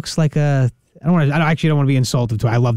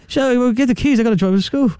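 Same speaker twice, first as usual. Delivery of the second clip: higher, stammering befuddled dad; muddled dad asking where his daughter is; 7 semitones higher than usual. Pretend. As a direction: play a dazed, muddled father.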